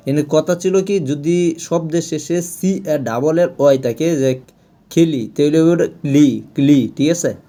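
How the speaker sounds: ordinary talk, nothing marked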